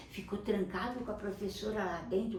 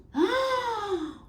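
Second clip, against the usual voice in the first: sharp high gasp of fear; scared boy gasping as he raises his arms; frightened gasp; 12 semitones higher, 4 dB louder than usual. Acted.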